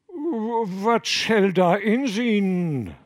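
very slow, high dialect fisherman; fishermen answering evasively. Acted. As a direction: mumble it off, looking away. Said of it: "antworteten sie mit abgewandten Gesichtern".

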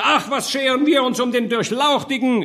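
very high, scornful yell; shouting over someone. A narrator's reading. Burghers cut the gentleman off, shouting over him. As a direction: drown the speaker out. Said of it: "überschrie man ihn".

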